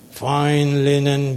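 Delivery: ordinary talk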